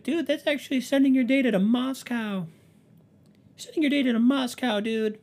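very high, loud mocking voice; mouse-like squeal. Mocking people who warn about the photo app, mouse-like squeal. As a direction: squeak it out in shrill alarm, like a mouse. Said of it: "I sound like a mouse".

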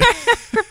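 a big real laugh bursting out; loud burst of laughter. Real, not acted. Amused by the host's teasing, a loud real laugh. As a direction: crack up loudly at the joke. Host jokes she sucked at accent.

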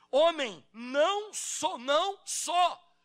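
slow, loud stretched no; slow denial. Acted. Peter denying to the man, slow and loud. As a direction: draw each no out slowly.

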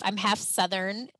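ordinary talk

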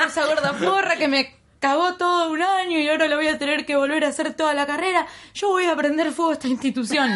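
high, laughing mock rage at the school; retold furious rant. Retelling her outburst at school staff, laughing mock fury. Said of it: "Le empecé a decir que iba a prender fuego".

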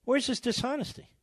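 can you believe it; mock-incredulous where's his dishonesty; incredulous repeat. Real, not acted.

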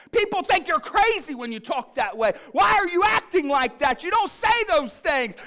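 loud, high-pitched imitation of a fed-up wife; exasperated wife's tirade, acted out; mock angry scolding. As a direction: rant at the family, hammed-up and shouting. Wife scolding family in car, retold.

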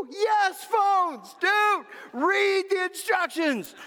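very high-pitched shout at the end of patience; exasperated mock yell. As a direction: yell at the clueless traveler, hammed up. Acted yell at clueless traveler.

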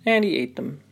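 usual speaking voice

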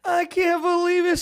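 high, tearful mock cry; imitating Kim crying over the gift; mock sobbing. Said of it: "She's like".

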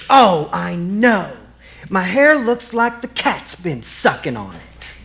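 slow, mildly voiced elderly mother; a hint of the old woman laughing through tears; old woman's voice. Daughter recalls her mother's words at the hospital bedside, lightly voiced old woman. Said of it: "she begins to hear her voice again".

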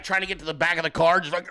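ordinary talk, nothing marked

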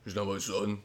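a hint of a gruff old soldier; Big Boss denying his son, lightly voiced. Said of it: "Big Boss is like".

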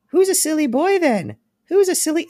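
very high, cooing doll taunt; talking doll voice. Retold or imitated. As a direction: coo it like a creepy toy. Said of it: "this voice. It says".

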